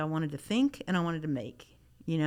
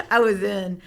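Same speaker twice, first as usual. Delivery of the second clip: laughing and louder; 4 semitones higher, 10 dB louder than usual. Real, not acted. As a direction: laugh it out, then say it. Laughing, all in on school.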